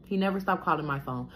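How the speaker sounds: usual speaking voice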